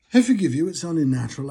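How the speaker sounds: loud sarcastic absolution; mock forgiveness. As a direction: boom a mock pardon. Mock magnanimity to pundits.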